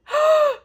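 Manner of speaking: high, loud gasp; sudden astonished gasp; shocked gasp. Real, not acted. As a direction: gasp in real amazement. Shocked by the gift.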